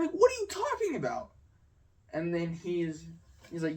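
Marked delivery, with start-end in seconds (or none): 0.1-1.3 high, baffled retort; incredulous. Retold or imitated. Retelling arguing with a classmate, a high baffled retort. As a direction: act like he's lost his mind. Retold clash over cameras.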